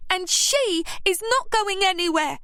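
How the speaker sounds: put-on high, sharp young voice; snapped. A narrator's reading. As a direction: snap it back at her. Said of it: "snapped Scarlett".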